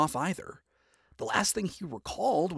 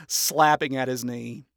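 usual speaking voice

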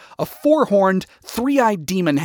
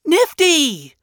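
high-pitched cry of a name; startled call; 12 semitones higher than usual. Acted. Charlie spotting Nifty in the air, startled call. Charlie spots her friend.

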